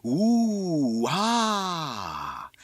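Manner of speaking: long, gliding awestruck cry; crowd's fireworks ooh and ah. A narrator's reading. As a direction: swell high, then slide down in wonder. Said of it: "gave out a long Fourth of July fireworks".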